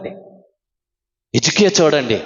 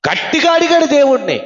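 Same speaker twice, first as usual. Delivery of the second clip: normal talking voice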